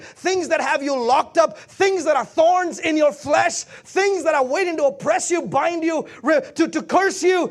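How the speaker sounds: rhythmic pulpit list, rising steadily; mounting preacher's cadence; building preaching. Acted. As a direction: stretch each phrase and build intensity.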